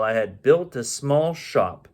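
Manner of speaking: plain reading voice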